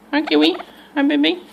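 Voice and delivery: high, loud cooing hello to a bird; excited greeting. Real, not acted. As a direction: greet the pet with delight.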